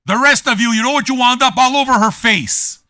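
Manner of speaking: high, loud jeering; loud insult. Real, not acted. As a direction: yell the taunt at the viewers. Ranting insult at chat.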